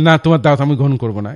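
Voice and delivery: loud, fast, blunt no; blunt refusal. Shown as a demonstration. Teacher acting out a rude refusal of an invitation. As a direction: turn the invitation down flatly and fast. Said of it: "এরকম বলি না".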